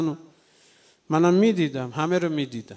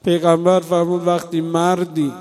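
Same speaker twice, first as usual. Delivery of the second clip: supplication. Acted. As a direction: plead gently, close to tears. Closing supplication.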